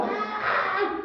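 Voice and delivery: high, theatrical wailing cry; mock dramatic wail. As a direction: ham up a newcomer's anguished cry. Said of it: "como le hacen muchas que llegan llorando".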